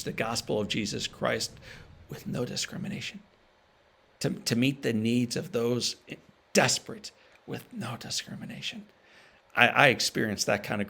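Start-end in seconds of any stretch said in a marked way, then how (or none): 2.1-3.2 whispered, softer, leaning on "no"; hushed emphasis on "no discrimination"; emphatic whisper. Real, not acted. Stresses key mission phrase. 6.5-8.8 whispered, softer, leaning on "no"; hushed emphasis on "no discrimination"; emphatic whisper. Real, not acted. Repeats key mission phrase.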